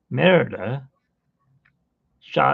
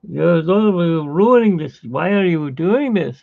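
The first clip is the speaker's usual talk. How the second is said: higher, louder, slower dismay; pleading king; 5 semitones higher, 6 dB louder, slower than usual. Retold or imitated. King watching Merida ruin the contest, higher exasperated pleading. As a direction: beg her to stop, exasperated. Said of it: "the king is like".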